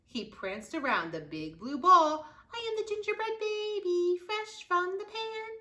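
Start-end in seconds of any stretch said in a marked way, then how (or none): 2.5-5.5 much higher, taunting little voice; cheeky gingerbread baby. Acted. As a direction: tease them like a cocky cookie. Gingerbread baby's taunting song.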